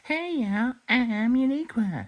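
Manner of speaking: high-pitched, drawn-out character introduction; chirpy character voice. Acted. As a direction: pitch it way up to greet everyone. Introduces herself as Uniqua.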